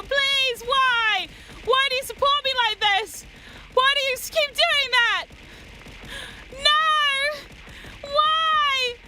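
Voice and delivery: high, loud playful wail; high whiny pleading. Real, not acted. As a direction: beg them to stop, whining.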